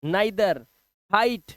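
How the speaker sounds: neither as "nigh-ther", हाइट as "hite"; eye sound. Shown as a demonstration. Teacher repeating neither and हाइट, eye sound. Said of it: "आई की आवाज आ रही है".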